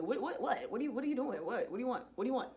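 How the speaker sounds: impatient, stammered questioning; irritated, rapid-fire repeats; annoyed. Retold or imitated. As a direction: brush off an unwelcome interruption. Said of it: "I'm annoyed".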